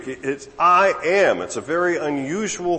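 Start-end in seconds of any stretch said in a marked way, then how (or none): 0.6-1.4 "I am" pitched high and hit loud; forceful, raised "I am"; emphatic I AM. Shown as a demonstration. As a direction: punch "I am" with full weight.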